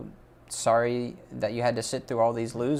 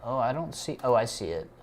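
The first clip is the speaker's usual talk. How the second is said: ordinary talk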